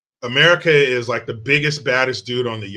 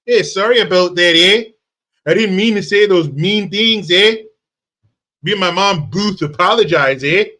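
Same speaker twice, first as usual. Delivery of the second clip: put-on higher, louder caricature Canadian accent; hammed-up polite Canadian with a lilting "eh"; mock Canadian apology; 4 semitones higher, 4 dB louder than usual. Imagined commenter apologizes like a true Canadian, mock Canadian accent. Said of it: "apologize like a true Canadian".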